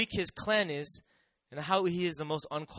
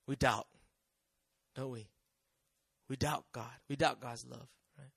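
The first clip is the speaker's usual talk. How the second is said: hushed confession; 6 semitones lower, 7 dB quieter than usual. Real, not acted. Quiet confession after a climax.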